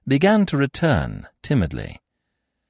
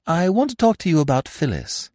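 Eve's voice; 8 semitones higher than usual. A narrator's reading. Eve speaks directly.

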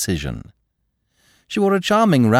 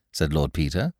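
plain reading voice

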